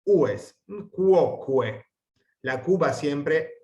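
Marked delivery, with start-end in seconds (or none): 0.9-1.8 cuoque drawn out slowly, both U's sounded; cuoque, slow and deliberate; slow pronunciation demo. Shown as a demonstration. Teacher repeating cuoque for the class, slowly. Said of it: "pronunciamos las dos U's".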